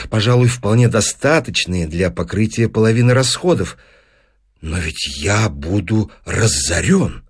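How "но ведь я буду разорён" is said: slow, low lament; dismayed. Acted. Professor facing financial ruin, dismayed. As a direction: dwell heavily on the looming ruin.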